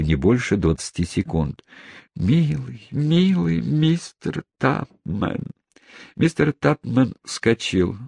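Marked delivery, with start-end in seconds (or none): 2.1-5.4 slow, high tender murmur. Acted. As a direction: linger lovingly over each word. Aunt tenderly over sleeping man. (медленная нежная ласка)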